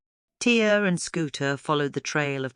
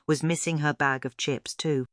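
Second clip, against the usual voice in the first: ordinary delivery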